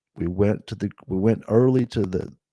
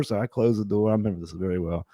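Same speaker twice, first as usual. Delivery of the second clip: normal talking voice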